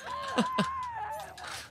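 shrill relieved laugh after a scare; high nervous laugh. Real, not acted. Player recovering from a jump scare, high nervous laugh. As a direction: laugh off the fright.

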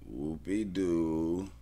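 whoop-a-doo stretched very slow in an odd mocking voice; put-on sarcastic voice. Real, not acted. Bettor mocking a team's weak wins, slow sarcastic sing-song. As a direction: drag it out, sneering.

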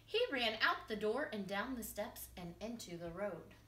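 ordinary delivery

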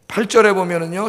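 usual speaking voice

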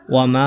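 ওয়ামা with a very long, level final aa; long madd elongation. Shown as a demonstration. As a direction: hold the end of ওয়ামা on one steady note. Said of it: "এখানে কি হবে?".

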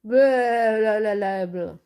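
slow, loud mocking blablabla; mock chatter. A narrator's reading. Sula mocks Bing's noise with slow loud blablabla. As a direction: drag out the noise. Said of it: "děláš velký rámus".

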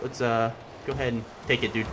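ordinary talk

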